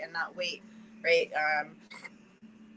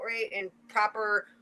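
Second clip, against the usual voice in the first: ordinary talk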